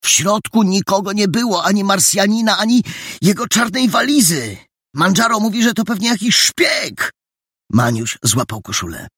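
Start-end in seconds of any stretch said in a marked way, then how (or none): 0.0-7.1 put-on high, urgent boy's voice; worked-up young detective; excited report. A narrator's reading. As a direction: tell the mystery excitedly, pitched high. Boy excitedly reports the mystery.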